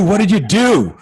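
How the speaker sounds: "What did you do" pitched high and loud; loud, high re-enacted demand; exasperated insistence. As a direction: hammer the question home as an acted-out exasperated dad. Emphatic repeat correcting first asking.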